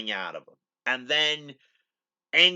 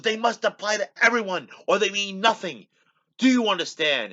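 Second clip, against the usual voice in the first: raised, higher voice, hammed-up captain's speech; impassioned speech; 9 semitones higher, 5 dB louder than usual. Kirk lecturing the native chief, in a raised impassioned voice. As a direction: preach it at him, loud and high.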